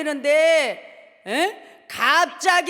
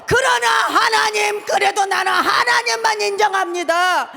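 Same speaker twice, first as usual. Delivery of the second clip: full-voiced pleading prayer, louder and higher; shouted prayer; 6 semitones higher, 7 dB louder than usual. Shown as a demonstration. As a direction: cry out to God at full force. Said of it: "그러면 뭐라고 해야 돼요?". (외치는 기도)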